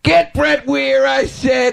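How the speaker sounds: high, drawn-out demanding prank caller; hammed-up crank caller losing patience. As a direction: raise the pitch way up and insist. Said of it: "I could do the whole call".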